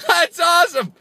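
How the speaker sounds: squealing high delight at himself; mock excited. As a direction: gush like a fan. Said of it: "be like".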